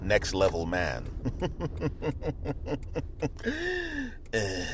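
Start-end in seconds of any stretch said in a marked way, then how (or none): 1.1-4.3 a long high laugh, softer and fading. Real, not acted. Boasting he is a next level man: long high laugh.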